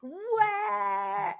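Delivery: high, drawn-out mock-French "What"; teacher's French accent. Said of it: "with her accent. I'm gonna be just like her".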